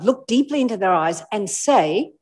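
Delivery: ordinary delivery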